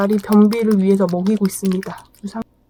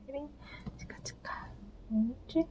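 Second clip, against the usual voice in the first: whispering; 3 semitones higher, 18 dB quieter than usual. Real, not acted. Whispering to dog.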